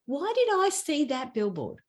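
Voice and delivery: ordinary talk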